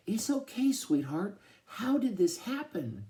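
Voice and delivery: high, tearful motherly voice; near tears. A narrator's reading. Mama comforts her stuck son, high and near tears. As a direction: comfort him while about to cry. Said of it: "sounded like she's gonna be crying any minute".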